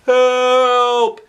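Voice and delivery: high, loud cry for help; shout. Acted. Principal dangling from flagpole shouting for help. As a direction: yell it out, stuck and scared. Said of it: "he shouted".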